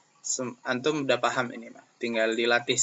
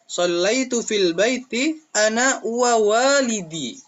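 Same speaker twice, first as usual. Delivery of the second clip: slow, higher recited sentence; teacher models the new answer, slow and high; 8 semitones higher, 4 dB louder, slower than usual. Shown as a demonstration.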